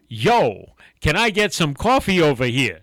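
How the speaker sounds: hammed-up Jersey demand, punchy; badly faked Jersey accent, calling out; bad New Jersey accent. Rude customer demanding coffee, terrible New Jersey accent. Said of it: "my accent is terrible".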